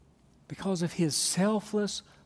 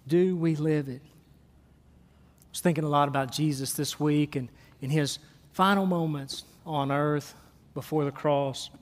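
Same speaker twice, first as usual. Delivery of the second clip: ordinary talk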